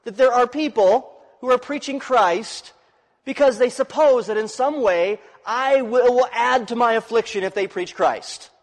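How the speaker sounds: a lifted apostle's voice quoting the letter; quoting Paul. Retold or imitated. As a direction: read Paul's words as a pleading apostle.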